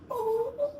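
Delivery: very high, very slow tearful oh my gosh; overwhelmed bride-to-be crying with joy; emotional crying cry-out. Retold or imitated. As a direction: break into happy tears. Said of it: "I actually sounded exactly like that".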